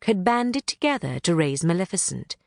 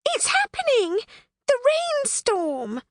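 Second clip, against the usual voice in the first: much higher, panicky pixie voice, dragging out rainstorm; alarmed pixie. Acted. Thistlewit shouting that the rainstorm has come, high comic alarm.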